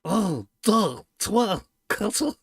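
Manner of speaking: lightly hammed-up throaty French accent; mock throaty French. Showing off fake Quebec French to the host, throaty French accent. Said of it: "throaty French".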